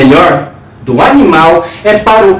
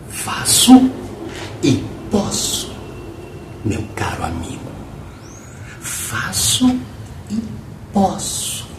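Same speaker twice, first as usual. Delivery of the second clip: whisper; 4 semitones lower, 14 dB quieter than usual. Acted. Confidential whispered boast.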